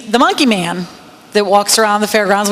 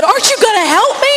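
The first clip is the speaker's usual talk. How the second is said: imitated plea pitched much higher; retold panicked plea; 11 semitones higher than usual. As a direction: play the girl begging for help as the monkey attacks. Monkey attacking, girl pleads.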